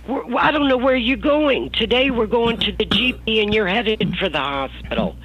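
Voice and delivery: high, loud indignant protest; mother protesting. Retold or imitated. Mother arguing about the GP and hospital, loud protest. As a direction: push back hard at her daughter.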